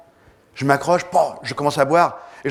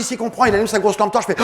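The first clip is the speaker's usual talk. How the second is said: ordinary delivery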